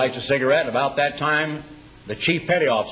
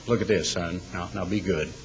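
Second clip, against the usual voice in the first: put-on lower, softer old-lady coaxing; gentle warning; 7 semitones lower, 6 dB quieter than usual. Sweet old lady showing the child the rod, soft and gentle.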